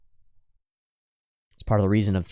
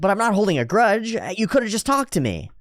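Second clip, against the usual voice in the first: voicing Lucy; 10 semitones higher than usual. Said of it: "It's like".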